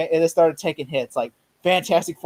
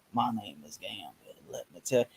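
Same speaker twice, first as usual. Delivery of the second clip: low, soft, slow cartoon character voice; Gambit; 9 semitones lower, 12 dB quieter than usual. Imitating the animated series character, low slow drawl. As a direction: drop deep and quiet, drawing out the words. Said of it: "animated series version of Gambit's voice".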